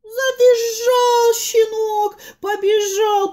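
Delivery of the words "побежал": ordinary delivery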